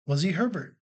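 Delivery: usual speaking voice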